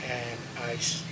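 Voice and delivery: ordinary talk, nothing marked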